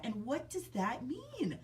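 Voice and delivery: usual speaking voice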